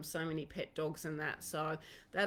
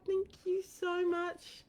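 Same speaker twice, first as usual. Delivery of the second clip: tender thanks; 11 semitones higher, slower than usual. Real, not acted. Reading from a gift card.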